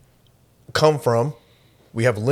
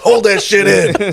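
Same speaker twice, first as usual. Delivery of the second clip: laughing yell; 10 semitones higher, 8 dB louder, slower than usual. Acted. Friends mock-yell advice, laughing.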